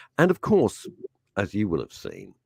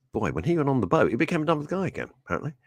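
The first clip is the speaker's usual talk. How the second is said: ordinary delivery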